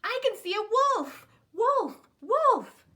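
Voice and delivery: high, loud call for help; boy calling the village men, loud fake alarm. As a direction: shout it up the hill. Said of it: "he called".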